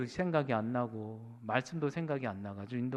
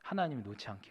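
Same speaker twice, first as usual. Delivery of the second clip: normal talking voice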